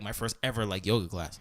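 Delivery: ordinary talk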